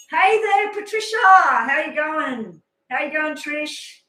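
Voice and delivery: high, loud cheery hello; bright greeting. Real, not acted. Greeting a new viewer brightly.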